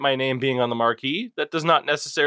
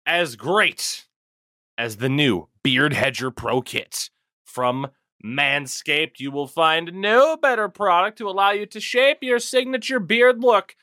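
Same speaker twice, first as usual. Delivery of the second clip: ad read; 5 semitones higher than usual. Acted.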